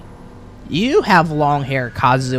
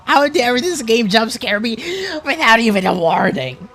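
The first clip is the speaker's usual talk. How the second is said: loud indignant rant, pitched up; scared horror game streamer; 10 semitones higher, 5 dB louder than usual. Real, not acted. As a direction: shaken and outraged at the game. Streamer ranting after jump scare.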